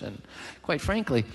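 normal talking voice

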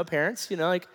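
normal talking voice

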